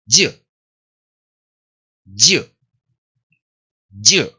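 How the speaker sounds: "Ju" as a short, firm dyuh; strong Y after D. Shown as a demonstration. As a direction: punch out isolated "Ju" for repetition. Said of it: "la D medio solapadita y la Y más fuerte".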